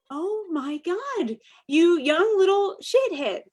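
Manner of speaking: put-on drawn-out exasperation at a cheeky kid; mock outrage. Author retelling her outrage at the young model. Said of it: "And I'm like".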